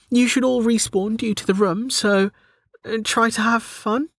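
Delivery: high-pitched, faintly unsure encouragement; weak. A narrator's reading. Delta encourages her fish, weak high voice. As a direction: offer it weakly, hesitating before the end. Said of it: "she said weakly".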